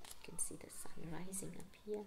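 whispered aside pointing at the page; soft whispered aside. Real, not acted. Aside to child while reading.

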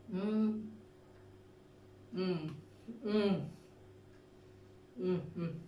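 chewing while humming; humming with food in mouth; mouth full. Real, not acted. Seen 0: Bites cake at 0.2s, then chews with closed mouth throughout.

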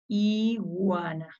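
"Iguana" read slowly, both parts drawn out; sounding out. Shown as a demonstration. Teacher reading along with children, "Iguana" slowly stretched. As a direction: stretch "Iguana" for beginning readers. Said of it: "¿Me ayudan a leer?".

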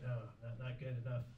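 soft, sheepish mumble; ashamed mumble. Retold or imitated. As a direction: admit it quietly, ashamed. Ashamed admission.

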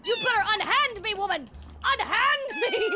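loud, high melodramatic protest; mock-outraged shouting. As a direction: holler as if grabbed, hamming it up. Mock struggle with game character.

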